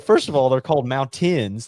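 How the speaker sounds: "mountains" with a clearly sounded T. Retold or imitated. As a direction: correct them, hitting the T in "mountains". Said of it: "You say it with a T".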